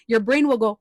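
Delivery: ordinary delivery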